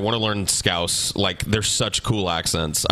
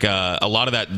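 ordinary talk, nothing marked

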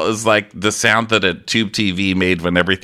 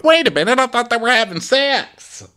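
high squeaky mock surprise; mock shocked. A viewer fooled by the scene, in a high mock voice. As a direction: play it as a dupe caught off guard. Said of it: "I'm like".

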